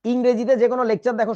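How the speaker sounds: normal talking voice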